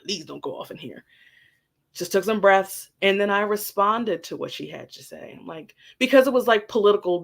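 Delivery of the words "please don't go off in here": hushed, pleading words to herself; calm self-talk. Retold or imitated. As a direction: talk yourself down under your breath. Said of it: "I just calmly just was breathing internally".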